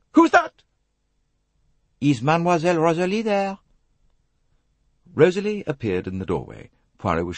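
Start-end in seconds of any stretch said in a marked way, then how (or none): 0.1-0.6 startled, very high, loud call; Mrs Otterbourne. A narrator's reading. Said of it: "Missus Otterbourne's voice called out". 2.0-3.6 put-on French-accented detective calling, higher and louder; Hercule Poirot. Poirot calling at cabin door.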